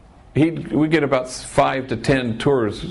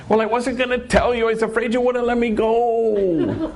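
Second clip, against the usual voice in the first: put-on high, little-kid voice with a nervous laugh; guilty child, half laughing; 11 semitones higher than usual. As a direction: sound like a kid caught out. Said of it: "Like a little kid".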